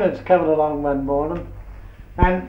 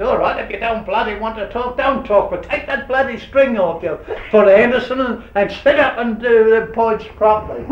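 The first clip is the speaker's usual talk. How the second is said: higher, louder mock telling-off; scolding boss; 6 semitones higher, 4 dB louder than usual. McKinnon to Anderson at the points, mock higher scolding. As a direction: play the boss snapping at a lazy worker.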